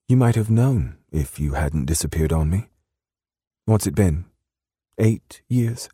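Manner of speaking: put-on British accent, pitch climbing on the questions. A narrator's reading. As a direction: challenge him in a British accent. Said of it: "in her soft British accent".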